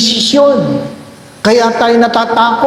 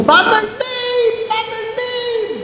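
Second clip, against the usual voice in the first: calling out; 13 semitones higher, 6 dB quieter, slower than usual. Retold or imitated. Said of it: "may sumigaw".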